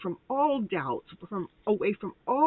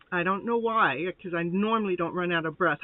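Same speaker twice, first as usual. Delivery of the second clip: normal talking voice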